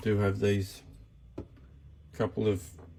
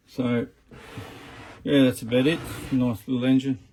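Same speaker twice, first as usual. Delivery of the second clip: raised voice over loud engine noise; shouting over engine; 7 dB louder than usual. Real, not acted. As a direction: project loudly over a running motor. Talking over running engine noise.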